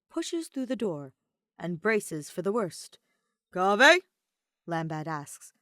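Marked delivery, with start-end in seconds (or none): 3.5-4.0 loud rising surprised call. Acted. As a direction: call his name in surprise. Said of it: "seemingly surprised".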